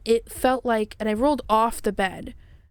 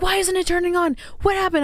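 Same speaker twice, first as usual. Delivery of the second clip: much higher, bewildered retold questions; imitated alarm; 8 semitones higher, faster than usual. Partner finding the light won't turn on, imitated alarm. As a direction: act out baffled panic, pitched way up.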